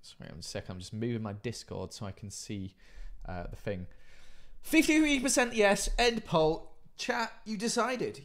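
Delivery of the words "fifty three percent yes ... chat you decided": excited announcement. Real, not acted. Announcing the poll result.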